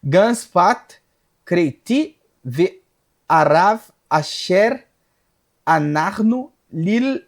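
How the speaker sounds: slow, deliberate Hebrew verse reading; measured recital of sacred text in Hebrew; scripture recitation. Real, not acted. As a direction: read out Hebrew scripture word by word, with pauses.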